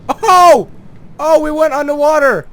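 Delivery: high, loud yelp of amazement; astonished shout. Real, not acted. Gamer suddenly shot underwater by recoil, astonished shout. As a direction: burst out shouting at the surprise.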